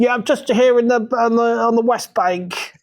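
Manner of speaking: high mock war reporter on a crackly phone line; war correspondent. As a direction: do a hammed-up telephone war correspondent. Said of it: "You sounded like a, an old war correspondent on the phone".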